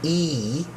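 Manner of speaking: EE drawn out long and steady; long E sound. Shown as a demonstration. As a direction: hold a long, clear "E". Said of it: "the long E sound".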